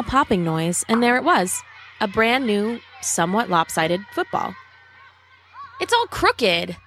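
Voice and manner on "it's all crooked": put-on mildly complaining boy's line, pitched higher. A narrator's reading. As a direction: grumble it with raised pitch. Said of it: "Rob complained".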